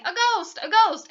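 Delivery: high, loud cries of alarm; yelling. A narrator's reading. Townspeople see a floating dressing gown, yelling. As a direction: cry out as a panicked crowd. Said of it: "everyone yelling".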